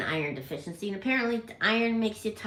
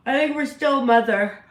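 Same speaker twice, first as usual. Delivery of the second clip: louder; 5 semitones higher, 10 dB louder than usual. Acted. Repeats line to bird, louder.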